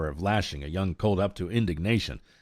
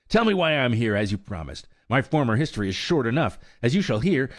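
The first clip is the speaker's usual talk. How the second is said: angry; 6 semitones higher, 3 dB louder than usual. A narrator's reading. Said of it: "stamping into the straw".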